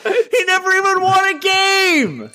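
high, loud, slow shocked cry; incredulous outburst. Real, not acted. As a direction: shout it in stunned disbelief. Outraged disbelief at the record.

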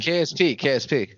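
loud impatient call. Real, not acted. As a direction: call his name over and over. Calling on friend loudly.

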